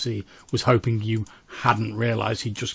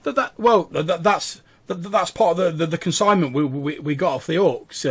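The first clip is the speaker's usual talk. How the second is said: flustered, higher stuttered explanation; nervous stammering; 6 semitones higher, 6 dB louder than usual. Acted. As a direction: stumble over an awkward excuse. Scared captive explains pouch.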